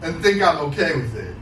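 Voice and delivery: angry cow voice in a slam poem; slam poetry performance. Acted. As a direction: rage at them through the poem as the cow.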